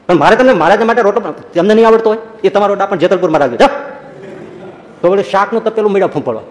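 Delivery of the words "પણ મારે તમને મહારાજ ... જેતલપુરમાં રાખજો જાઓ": raised, higher voice sending her off; angry dismissal. Retold or imitated. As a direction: shoo her out of the kitchen, heated. Jivuba throws Gangama out, quarrel. (ગુસ્સાથી કાઢી મૂકવું)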